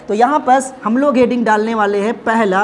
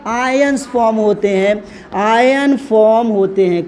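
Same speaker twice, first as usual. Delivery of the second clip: slow, drawn-out dictation; dictating while writing; 2 semitones lower, slower than usual. Real, not acted. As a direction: say it slowly as you write it on the board. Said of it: "इधर क्या लिखूँगा बेटा".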